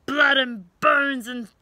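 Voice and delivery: loud, slow menacing dinosaur pirate; yelling T-Rex. Acted. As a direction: roar it out, hungry for meat. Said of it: "yelled the T-Rex".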